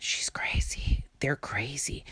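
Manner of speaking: whispered like gossip; hushed gossip whisper; mock whisper. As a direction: breathe it as a secret. Mocking what gossips say.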